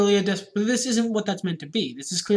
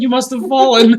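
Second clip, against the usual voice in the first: a louder, hammed-up innocent excuse; Lana's real wording, loud and mock-innocent; innocent denial; 5 semitones higher, 12 dB louder than usual. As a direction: correct the quote, same mock innocence. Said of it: "I think she actually said".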